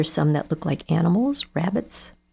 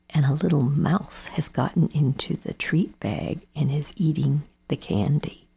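usual speaking voice

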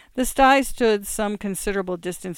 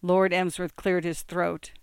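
ordinary delivery, nothing marked

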